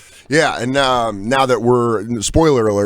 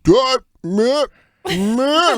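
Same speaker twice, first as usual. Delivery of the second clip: high, wordless double-takes; mock startled recognition; 13 semitones higher, much slower than usual. Stranger half-recognising the TV actor, startled double-take. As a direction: imitate a stranger puzzling over a face. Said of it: "it's usually people who are like".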